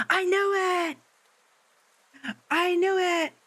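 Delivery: high, thrilled crowing; triumphant squeal. Real, not acted. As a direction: squeal it out, vindicated. Streamer's guess proved right.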